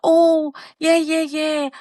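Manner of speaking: ordinary talk, nothing marked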